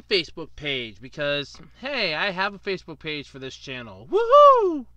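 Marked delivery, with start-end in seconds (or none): 4.1-4.9 high, loud whoop; excited cheer. Real, not acted. Mock cheer about Facebook page.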